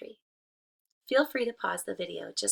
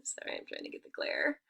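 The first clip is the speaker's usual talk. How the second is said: ordinary delivery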